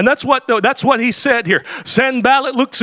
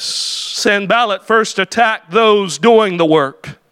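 ordinary delivery

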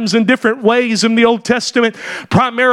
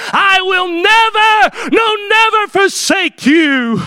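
preaching climax; 7 semitones higher than usual. Acted. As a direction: cry the promise out to the church. Preacher climax quoting Savior.

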